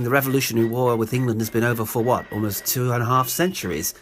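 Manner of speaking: plain reading voice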